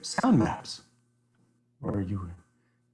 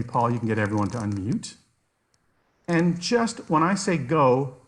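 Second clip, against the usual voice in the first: normal talking voice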